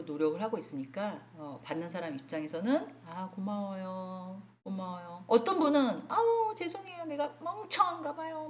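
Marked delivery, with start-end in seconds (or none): 3.1-5.2 grateful thanks. Retold or imitated. Grateful reply to kind staff. 6.1-8.5 self-deprecating apology. Retold or imitated. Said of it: "막 이러고".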